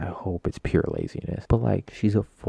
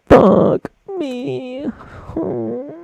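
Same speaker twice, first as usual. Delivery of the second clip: high outburst; 6 dB louder, much slower than usual. Real, not acted. Outburst after laughing fit.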